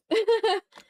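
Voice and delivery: a sudden high loud laugh that breaks out; burst of laughter. Real, not acted. Streamer bursts out laughing at chat, a loud high laugh. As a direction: laugh out loud, then catch yourself.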